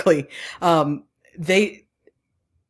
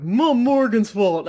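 put-on drawn-out, higher drunken slur; 4 semitones higher, slower than usual. Podcaster quoting the drunk character she loves, drunken. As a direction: drag it out like a drunk. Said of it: "when he's the drunk".

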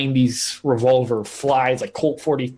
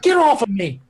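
higher-pitched mock shout; acted shouting; 8 semitones higher than usual. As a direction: shout it in character, not full force. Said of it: "like, shouting".

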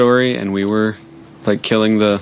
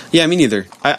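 ordinary delivery

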